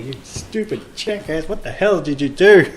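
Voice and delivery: mock yell. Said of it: "constantly saying".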